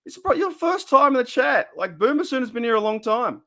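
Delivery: high-pitched indignant scolding. Real, not acted. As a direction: tell the newcomer off. Scolding a new chatter.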